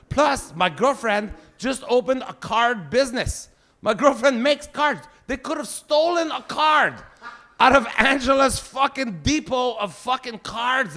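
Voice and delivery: hurt father complaining about no birthday card; indignant complaint. Acted. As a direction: whine about being forgotten.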